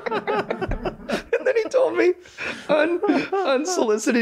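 slow words pushed out through real laughter, pitch way up; can barely get "unsolicited" out for laughing. Real, not acted.

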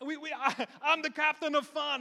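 loud, high mock Jamaican cruise host; hammed-up Jamaican captain of fun; Jamaican accent. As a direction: put on a big Jamaican emcee accent. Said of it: "he has a Jamaican accent".

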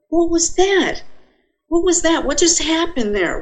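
high, stunned "what was that"; shocked bewilderment. Retold or imitated. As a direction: gasp it out, completely thrown. Snapped back into his body.

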